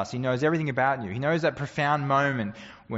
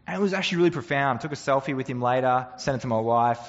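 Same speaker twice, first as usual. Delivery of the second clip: ordinary talk, nothing marked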